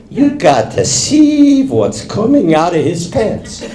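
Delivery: mildly high, drawn-out astonishment; a touch of scandalized amazement; astonished urging. Acted. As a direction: slowly urge a friend to look. Woman urges friend to look.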